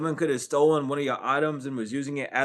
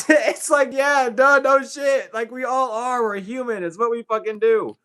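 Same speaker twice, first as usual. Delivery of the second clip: much higher, louder laughing rant; laughing outburst; 10 semitones higher, 10 dB louder than usual. Real, not acted. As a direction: burst out laughing, loud and high. Laughing outburst about being human.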